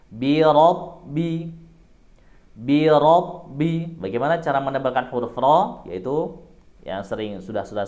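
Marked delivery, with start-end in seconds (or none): 0.1-4.0 "Rabbi" slow, with a heavy, back-of-mouth rolled ro; thick ro in Rabbi. Shown as a demonstration. Teacher models "Bi Rabbi" slowly for students, with a heavy ro. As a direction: draw out "Bi Rabbi", raising the back of the tongue. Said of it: "fathah dibaca tebal".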